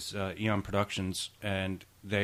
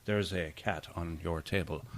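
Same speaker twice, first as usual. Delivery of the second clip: lightly voiced, low and soft Robert Vaughn; a hint of Robert Vaughn's smooth low murmur; Robert Vaughn impression; 5 semitones lower, 6 dB quieter than usual. Asked to do his impression, a soft low Robert Vaughn. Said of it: "My impersonation of Robert Vaughn".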